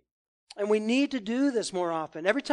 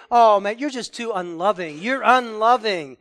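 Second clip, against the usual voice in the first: loud mock accusation; 2 semitones higher, 8 dB louder than usual. Preacher voices critics.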